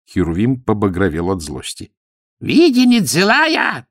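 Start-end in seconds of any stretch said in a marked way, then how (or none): 2.4-3.9 high, slow, squealing broken Russian; shrill squeal. A narrator's reading. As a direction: squeal it out in hurt anger. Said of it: "взвизгнул он".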